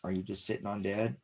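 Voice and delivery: ordinary talk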